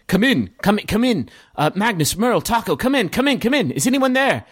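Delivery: high, anxious radio call; urgent Director. Acted. Director calling the three boys, high urgent voice. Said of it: "the director saying".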